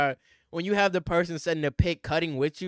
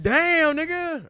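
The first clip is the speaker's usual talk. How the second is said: imitating player; 11 semitones higher, 6 dB louder, slower than usual. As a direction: voice his shocked reaction.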